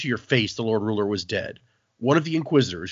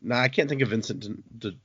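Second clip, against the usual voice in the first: usual speaking voice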